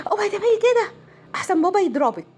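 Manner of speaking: a hammed-up mother's warning; mock warning mother. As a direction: play the mum threatening her kid with dad. Mother scaring child with father.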